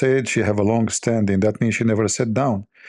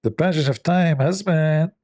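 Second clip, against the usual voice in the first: higher nasal imitation; mock her nasal drone; nasal wife voice, lightly mocking; Kamala Harris impression; 6 semitones higher, slower than usual. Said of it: "speak like that from her nose".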